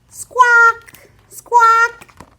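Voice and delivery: parrot squawk. Retold or imitated. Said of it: "hear a parrot squawk".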